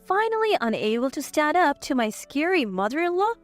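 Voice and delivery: ordinary delivery, nothing marked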